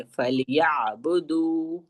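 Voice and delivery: فَلْيَعْبُدُوا slow, ya joined to the ain; teacher models the word slowly; careful recitation. Shown as a demonstration. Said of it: "یا کو عین کے ساتھ ملانا ہے".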